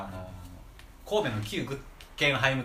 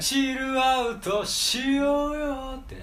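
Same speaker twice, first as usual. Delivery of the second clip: lazy high drawl; 4 dB louder, much slower than usual. Acted. Playful suggestion to chill out.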